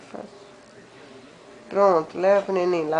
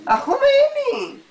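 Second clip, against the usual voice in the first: high, slow coaxing; 4 dB louder, slower than usual. Acted. Grandma urging toddler, playful.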